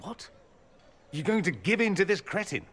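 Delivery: high-pitched, incredulous protest; indignant disbelief. Acted. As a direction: object in sheer disbelief, voice jumping up. Protests friend yielding to merchant.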